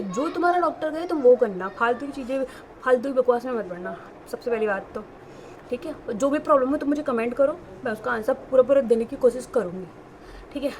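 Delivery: usual speaking voice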